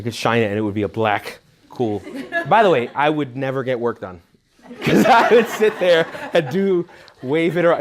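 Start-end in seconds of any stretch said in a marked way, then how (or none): 4.8-7.8 laughing. Real, not acted. Fantasizing playing with flash dark.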